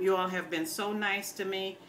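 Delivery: ordinary talk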